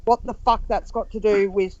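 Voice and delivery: usual speaking voice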